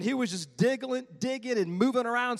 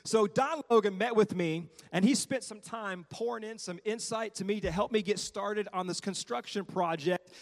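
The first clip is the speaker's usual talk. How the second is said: normal talking voice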